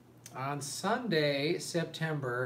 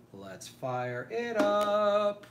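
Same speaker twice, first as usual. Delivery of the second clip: higher, slow, stretched-out hype call; drawn-out hype call; 7 semitones higher, 4 dB louder, much slower than usual. Acted. Hyping start of live stream.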